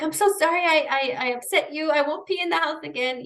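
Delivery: high, sorry little puppy voice; dog's voice. Retold or imitated. Luna the dog apologizing to her owner in a high puppy voice. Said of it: "she's like".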